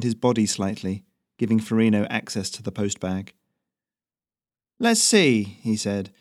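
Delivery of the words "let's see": high, bright courier voice, louder; chirpy messenger reading the mail; Ferrino's voice. Acted. Messenger checks the mail, high and lively.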